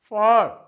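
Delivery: "for" alone, loud, then a stop; a loud, separate "for"; syllable set apart. Shown as a demonstration. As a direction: punch out "for" as a single syllable and pause. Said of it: "रुक जाते हैं".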